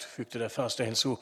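usual speaking voice

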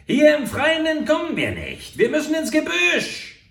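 very high and loud, frantic; desperate shout. A narrator's reading. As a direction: cry it out in panic on horseback. Fleeing, shouting to partner.

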